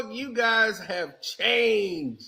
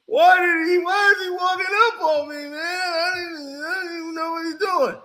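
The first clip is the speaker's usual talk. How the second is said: put-on high, crying shout of an upset kid; whiny son; 4 semitones higher, faster than usual. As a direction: wail it like a kid running to his dad. Son voiced complaining about opponent.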